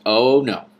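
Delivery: loud, emphatic no; firm objection. A narrator's reading. King refusing the princess, loud and firm. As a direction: shut it down hard.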